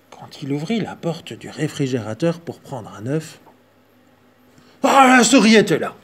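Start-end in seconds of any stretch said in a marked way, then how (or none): 4.8-5.9 louder, higher gasp of surprise; surprised reveal. A narrator's reading. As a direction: reveal it with a startled ah.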